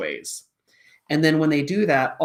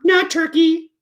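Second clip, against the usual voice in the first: high, loud kitty correction; puppet cat voice; 9 dB louder, slower than usual. Acted. Cat puppet corrects teacher, high and loud. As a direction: blurt it out as the cat.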